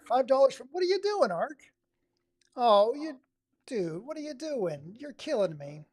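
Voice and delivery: high, loud astonished squeal; surprised. Real, not acted. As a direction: react in shock to the donation. Surprised by a super chat.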